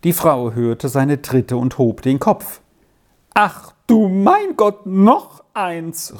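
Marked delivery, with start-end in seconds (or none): 3.3-6.2 high, dismayed cry from a grieving mother; a startled exclamation pitched high. A narrator's reading. Grieving mother sees another child at the door, crying out dismayed. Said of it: "rief sie bestürzt aus".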